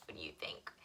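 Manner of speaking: soft secret whisper to the puppet; hushed aside to a puppet friend. Acted. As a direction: lean in and whisper the question.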